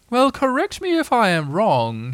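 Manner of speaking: high, slow, solemn owl. A narrator's reading. Owl solemnly questions guests, high slow voice. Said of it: "he said".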